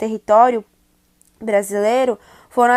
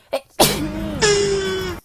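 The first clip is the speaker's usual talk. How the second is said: sneeze; 9 semitones higher than usual. Real, not acted. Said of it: "Peraí que eu vou espirrar".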